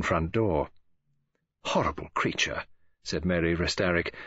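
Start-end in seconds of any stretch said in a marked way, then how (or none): 1.6-2.6 low, sharp rancour; rancorous. A narrator's reading. Mary after David has left, with rancour. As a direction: spit out your dislike without raising the voice. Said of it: "with a sharpness of rancour".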